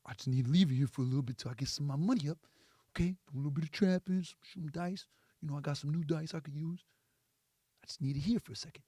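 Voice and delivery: low, hushed plea for a favour; pleading man. Acted. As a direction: beg softly, low in the voice. Man begging a favour in skit.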